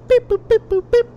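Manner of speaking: high sung beep boop dialing; phone dial beeps. Retold or imitated. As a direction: chirp the dial tones.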